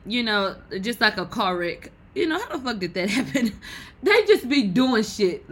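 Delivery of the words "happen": ordinary talk, nothing marked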